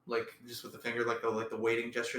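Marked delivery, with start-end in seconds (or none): none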